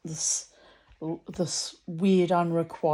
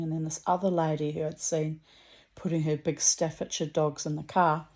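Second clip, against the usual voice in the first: ordinary delivery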